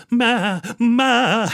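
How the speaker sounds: high, dainty "ma" bleats; refined ewe bleating high; elegant sheep bleat. Retold or imitated. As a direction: imitate a soft, elegant sheep. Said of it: "she was soft and she was elegant".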